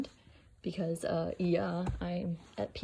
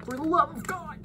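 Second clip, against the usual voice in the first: exasperated outburst; 8 semitones higher, 5 dB louder than usual. Real, not acted. Taco seasoning measuring mishap.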